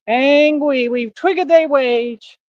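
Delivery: hammed-up "angwy" baby voice; mock baby talk. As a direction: tease like a whining toddler. Mocks angry critics in baby talk.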